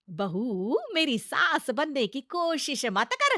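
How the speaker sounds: put-on loud, bossy old saas voice; nagging mother-in-law. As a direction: scold the bahu like a stern saas. (रौबदार सास की ऊँची डाँट)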